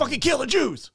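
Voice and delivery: sudden high-pitched imitated rant; mock hateful outburst. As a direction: blurt it as an imitated bigot. Acting out sudden hatred of bigots.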